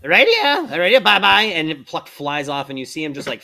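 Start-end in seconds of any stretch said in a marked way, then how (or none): 0.0-1.4 high, loud squawking parrot voice. Acted. The pet parrot squawking at the player, high parrot voice.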